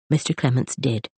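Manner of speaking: usual speaking voice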